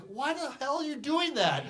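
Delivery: high, loud, incredulous outburst; exasperated. Retold or imitated. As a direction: re-enact being baffled and fed up, voice shooting up. Quoting his exasperated reaction at the time.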